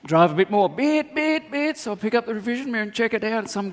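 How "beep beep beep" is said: high piping beep beep; imitated horn beeps. Jaguar driver honking from behind, high beeps. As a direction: honk like a car horn.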